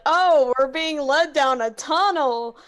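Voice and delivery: imitating a companion's loud warning; loud mock announcement. Player mimics Albus being led away, loud mock announcement. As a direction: call it out loudly, mimicking him. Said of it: "Albus is like".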